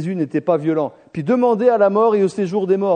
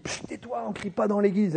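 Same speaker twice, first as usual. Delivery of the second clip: much softer, hushed scolding; hushed shush; 3 semitones higher, 9 dB quieter than usual. Retold or imitated. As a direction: shush him under your breath. Disciples hush him again.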